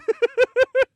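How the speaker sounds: high, loud cackle; high shrieking laugh. Real, not acted. Big laugh burst.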